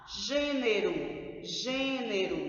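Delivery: "Gênero" slowly, with a long closed "gê"; closed stressed vowel. Shown as a demonstration. Teacher modelling the circumflex sound, slow "Gênero" with closed ê. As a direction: stretch the first syllable of "Gênero", closed ê. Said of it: "som fechado".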